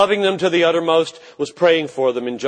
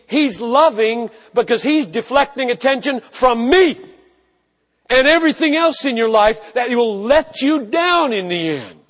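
much higher and louder, driving sermon climax; preaching at full force; 9 semitones higher, 7 dB louder than usual. Acted. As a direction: hammer home the love of Christ.

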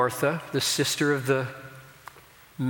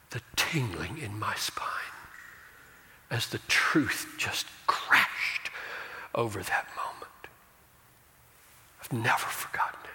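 awed whisper; 3 semitones lower, 4 dB quieter than usual. Real, not acted. Awed memory of the moment.

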